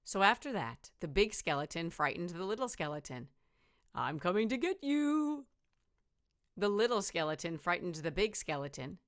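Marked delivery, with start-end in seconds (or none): 3.9-5.5 higher, playful spooky voice; mock spooky threat. Big skeleton frightens the little one, mock spooky. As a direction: pretend to be scary.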